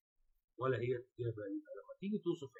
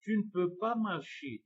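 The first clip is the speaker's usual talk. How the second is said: slow, raised reading; 6 semitones higher, 5 dB louder, much slower than usual. Shown as a demonstration. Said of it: "reading French question aloud".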